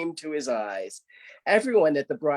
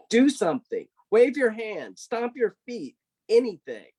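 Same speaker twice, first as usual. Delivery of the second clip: exasperated shout; 7 semitones higher than usual. Acted. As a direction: bark orders at a silent doll. Rabbit angrily orders poppet.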